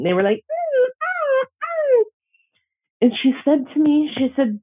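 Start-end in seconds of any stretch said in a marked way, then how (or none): 0.5-2.0 high whimpering ooh like crying dogs; imitated dog crying. Imitating the two dogs crying at her feet, high whimper. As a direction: mimic the dogs' high, whining cries. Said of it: "they were crying".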